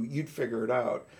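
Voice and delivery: ordinary talk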